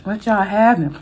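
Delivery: normal talking voice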